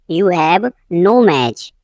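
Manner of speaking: "you have", a pause, then "no match"; slow teaching take, split before "no match"; slow repeat. Shown as a demonstration. Teacher repeating for learners, slow and divided.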